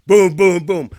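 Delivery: shouted booming knocks; three hard vocal bangs on a door; loud knocking imitation. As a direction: punch out each knock loudly. Imitating pounding every thirty seconds.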